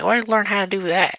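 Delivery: high-pitched mock astonishment; astonished. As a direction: imitate a shocked family's question. Family staring, astonished question.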